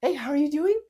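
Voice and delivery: very high greeting rising into a question; hurried, not calmed down. Shown as a demonstration. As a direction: pitch it way up, rushing to the question. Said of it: "and slow it down".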